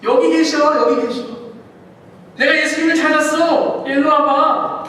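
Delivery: loud, high excited call; shouting. Acted. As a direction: yell to the others that he's found him. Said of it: "한 제자가 외칩니다".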